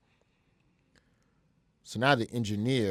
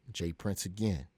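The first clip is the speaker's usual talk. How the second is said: ordinary delivery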